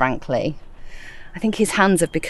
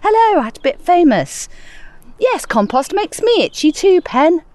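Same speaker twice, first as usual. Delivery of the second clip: imagined listener's email; 10 semitones higher than usual. Acted. Podcaster voicing a listener's reply, high mock email voice. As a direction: pitch it way up as a mock correspondent.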